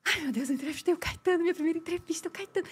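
high, soft dazzled gushing; breathless fan delight; starstruck gush. Retold or imitated. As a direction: gush like a starstruck fan who just met her idol. Starstruck after interviewing [name].

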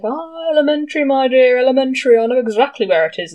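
a lightly voiced smug sleuth; Sherlock impression. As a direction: savour elementary like the great detective. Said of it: "And the Doctor was like".

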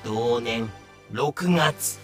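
normal talking voice

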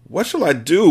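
high, helpless wail. A narrator's reading. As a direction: wail it as the panicking father. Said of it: "wailed the father".

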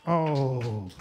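ordinary delivery

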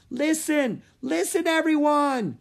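high, loud yell for attention. A narrator's reading. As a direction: make a noisy crowd hear you. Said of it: "he yelled".

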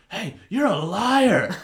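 loud, high, drawn-out heckle; retold heckling yell. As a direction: re-enact yelling from the front row. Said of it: "the whole time I was yelling".

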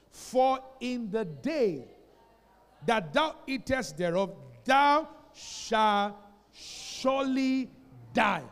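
loud, very slow, each word weighed; solemn scripture warning. Real, not acted. Pastor reading God's warning to Adam, loud and very slow. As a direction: let the warning land heavy.

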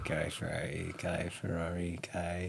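low mumbled guessing; muttering to self. Real, not acted. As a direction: mutter the names under your breath.

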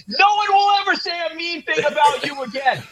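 high, hammed-up pledge; hype man. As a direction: declare it with soaring mock conviction. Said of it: "Hype him up so much".

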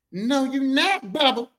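high, sassy refusal; imitated sassy objection; sassy boyfriend. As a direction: bar the door with full attitude. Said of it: "he got all sassy like this".

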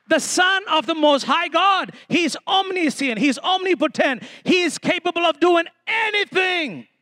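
high, building preacher's declaration; fervent proclamation. Real, not acted. Preacher exalting the Son of God to the room, fervent. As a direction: proclaim each title with rising fervour.

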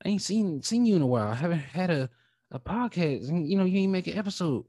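high, mock-curious voice of fans asking where he's been; imitating fans. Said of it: "I got a lot of people, man, that's like".

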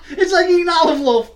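laughing through the words; giggling out the line. Real, not acted. Laughing after a vodka shot.

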